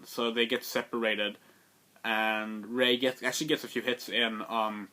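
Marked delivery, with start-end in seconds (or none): none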